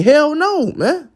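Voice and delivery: sharp high exclamation; indignant. Real, not acted. Preacher scoffing at forgiveness for Esau, high and indignant. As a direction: throw it out as an outraged refusal.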